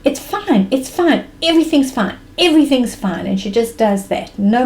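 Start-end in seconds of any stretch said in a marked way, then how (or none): 0.0-3.2 higher, repeated brush-off; dismissive interruption. Retold or imitated. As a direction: imitate mom cutting off the worry. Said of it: "She almost shuts me up mid-sentence".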